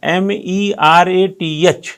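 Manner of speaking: M E R A T H letter by letter, slow; slow spelling. Shown as a demonstration. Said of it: "हमारा pronunciation क्या है". (धीरे धीरे spelling बोलते हुए)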